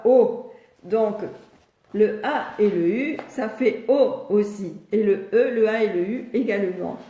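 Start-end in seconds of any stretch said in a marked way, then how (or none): 0.0-0.5 O said alone, long and clear, louder; a clear loud O demonstrated; the sound O. Shown as a demonstration. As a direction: model the vowel O for a child. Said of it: "il se fait comme ça".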